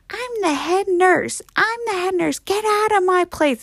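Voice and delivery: high, hammed-up nurse threat; menacing head nurse. Bloody head nurse to intruding visitors, mock menacing. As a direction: imitate a haunted house actor ordering people out.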